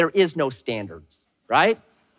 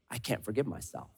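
low, much softer defeated sigh; despairing murmur; 7 semitones lower, 15 dB quieter than usual. Retold or imitated. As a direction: someone crushed by guilt. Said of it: "And then we say".

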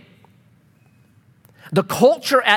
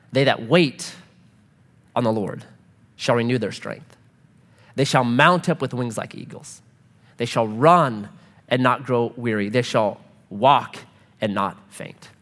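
lower, softer, measured reading; scripture reading; 7 semitones lower, 3 dB quieter than usual. Acted. Pastor reading Isaiah to the congregation, solemn. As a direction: read it as a solemn promise.

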